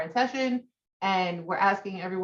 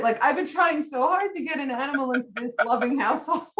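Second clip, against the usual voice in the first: words breaking between laughter and tears; laughing through tears; 5 semitones higher than usual. Real, not acted. Host tells friend of the rabbit, laughing through tears. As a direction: laugh and cry at once, higher and overcome. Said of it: "laughing while crying".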